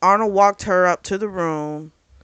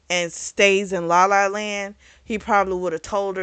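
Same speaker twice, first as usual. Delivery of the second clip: normal talking voice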